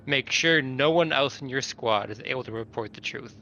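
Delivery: ordinary delivery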